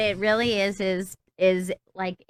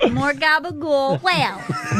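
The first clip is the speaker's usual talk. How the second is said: slow hammed-up Italian-American grocery list; Italian impression; 4 semitones higher, slower than usual. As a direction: lean into a joking Italian-American accent. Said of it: "such a racist Italian impression".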